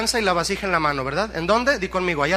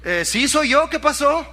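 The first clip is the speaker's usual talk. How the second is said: high, cocky comic reply; puffed-up pride; 8 semitones higher, 4 dB louder than usual. Acted. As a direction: answer as if puffing yourself up. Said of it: "se paró el cuello y dijo". (respuesta engreída, con voz aguda)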